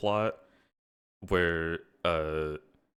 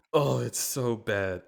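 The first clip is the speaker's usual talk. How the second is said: ordinary delivery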